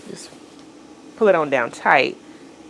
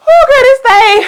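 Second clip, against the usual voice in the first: excited squeal; 9 dB louder than usual. Real, not acted.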